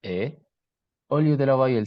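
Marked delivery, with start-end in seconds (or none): 0.0-0.3 E said as a front half-close vowel; E fermé antérieur; costeño E. Shown as a demonstration. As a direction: voice the costeño E clearly. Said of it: "La voyelle E est prononcée comme".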